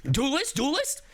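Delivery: high, rising disbelieving question; incredulous echo. Real, not acted.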